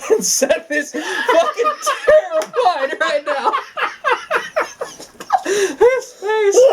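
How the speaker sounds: laughing at his friend's face; laughing hard. Real, not acted. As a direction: squeeze the words out through laughter. Laughing at Seth.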